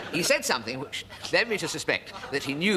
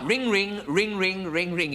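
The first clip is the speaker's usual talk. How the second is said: higher sung "ring, ring" like a bell; imitating ringing; 7 semitones higher, 3 dB louder, much slower than usual. Comic imitating the ringing in his ears. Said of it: "it used to go".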